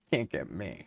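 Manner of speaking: mocking taunt. Taunting the agencies again.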